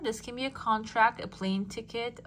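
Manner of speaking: ordinary delivery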